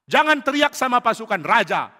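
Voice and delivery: high, loud rebuke; indignant shout. Acted. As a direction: shout back, defending the army's honour. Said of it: "Abner teriak".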